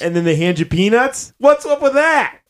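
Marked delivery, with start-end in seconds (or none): 1.4-2.4 higher, louder observational-comic voice; mock Seinfeld airline joke; Jerry Seinfeld impression. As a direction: toss it off like a stand-up bit. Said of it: "that's Jerry Seinfeld".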